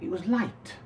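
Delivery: airy lift on light. Shown as a demonstration. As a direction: let the word light lift up. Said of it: "The word light says it all".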